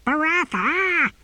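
very high, drawn-out cry; shouting tortoise. A narrator's reading. Tortoise demanding the novice's attention, high-pitched shout. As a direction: call the name in a high, stretched shriek. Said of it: "shouted the tortoise".

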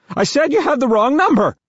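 high, shaky, panicked voice; shaky high-pitched man. A narrator's reading. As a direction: squeak it out, terrified. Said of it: "shaky and high-pitched".

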